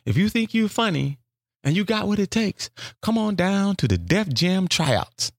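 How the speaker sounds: high, slow radio ad voice; mock radio ad announcer. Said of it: "And the radio says".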